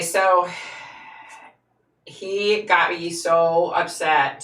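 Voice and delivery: usual speaking voice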